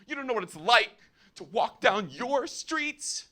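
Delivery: hammed-up high-pitched tirade; imitated yelling. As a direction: plead angrily as a mock outraged woman. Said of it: "She kept yelling at me".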